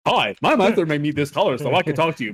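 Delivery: lightly voiced cartoon talking dog; a hint of the dog from Up; cartoony dog voice. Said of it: "kinda like the dog from Up".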